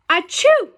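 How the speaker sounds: a big, high, acted sneeze; loud sneeze. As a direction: sneeze it out for the kids. Amos wakes with a sneeze.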